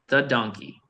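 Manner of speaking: ordinary talk, nothing marked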